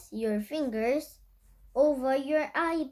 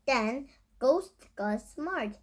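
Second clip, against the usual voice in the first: usual speaking voice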